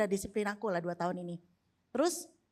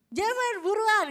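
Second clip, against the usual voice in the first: much higher and louder, a hammed-up plea; pleading cry; 10 semitones higher, 5 dB louder, slower than usual. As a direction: squeal it like a desperate mum. Wife's hurried plea quoted.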